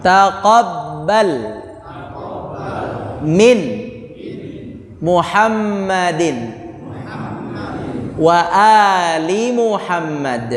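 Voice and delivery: drawn-out, measured prayer words; teacher modelling the prayer; slow recitation. Shown as a demonstration. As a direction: chant each word slowly for students. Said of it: "Caranya begini".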